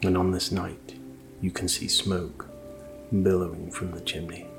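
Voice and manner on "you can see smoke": ordinary delivery, nothing marked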